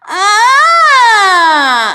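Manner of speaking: high, loud whining ah; whiny ah. Shown as a demonstration. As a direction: whine it like a crying child. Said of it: "Just whine. Say".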